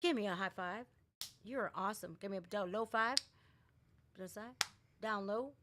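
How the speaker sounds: usual speaking voice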